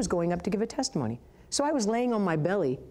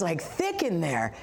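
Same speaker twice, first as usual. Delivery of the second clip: ordinary talk, nothing marked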